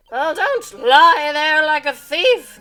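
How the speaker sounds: loud, slow, high witch shout; high crackly shout. Acted. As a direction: bellow it like a crackly old witch. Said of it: "shouts in a high, crackly voice".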